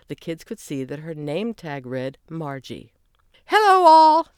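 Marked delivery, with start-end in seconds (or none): 3.5-4.3 high, loud cheery hello; cheerful greeting. A narrator's reading. Greeter welcoming the kids, cheerful and loud. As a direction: greet the group with big cheer. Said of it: "she said cheerfully".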